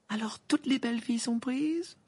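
very high, lofty question; haughty. A narrator's reading. Hermione taunting the boys without dates, haughty. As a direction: look down on them as you ask. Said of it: "d'un air hautain".

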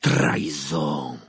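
loud, low, very drawn-out accusation; outraged exclamation. A narrator's reading. Karkaroff accuses Dumbledore, outraged exclamation. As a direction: hurl the word slowly and heavily. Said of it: "s'exclama-t-il".

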